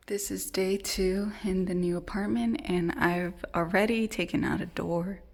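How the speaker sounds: hushed whisper. Real, not acted. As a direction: whisper so nobody wakes. Whispers through the vlog.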